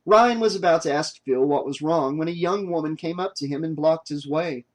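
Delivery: plain reading voice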